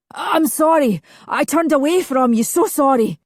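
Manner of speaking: high, loud pleading apology; desperate. Acted. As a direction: beg forgiveness in anguish. Desperate apology to drowning brother.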